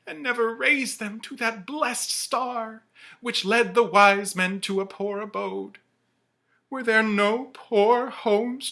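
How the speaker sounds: high, loud, slowing to a drawn-out wail; remorseful ghost. Acted. The ghost mourns his misused life, wailing high and slow. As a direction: lament your own wasted life.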